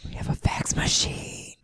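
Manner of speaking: soft, slow whisper; whispered aside. Retold or imitated. Quoting Karen's confession in a whisper. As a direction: whisper it like a guilty secret.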